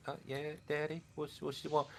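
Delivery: put-on high, soft little rat kid; a timid young rat, answering his father quietly; small rat son answering his dad. A little rat answering his father, high and soft. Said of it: "a smaller rat comes out of the wall and says".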